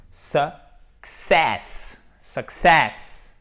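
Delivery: "success" slowly, weak "suk", strong SESS; stress on second syllable. Shown as a demonstration. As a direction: model "success" syllable by syllable, landing on the end. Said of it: "c'est l'accent tonique qui est sur cette seconde syllabe". (« success » lent, seconde syllabe appuyée)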